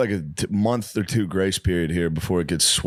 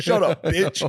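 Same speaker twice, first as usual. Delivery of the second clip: higher, ranting to nobody; imitating a street ranter; 10 semitones higher, slower than usual. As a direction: do the guy arguing with himself on the street. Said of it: "walk past you like".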